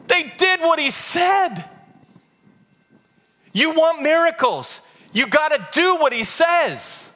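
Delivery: high, slow, forceful exhortation; emphatic preaching. Acted. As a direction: drive each phrase home to the congregation.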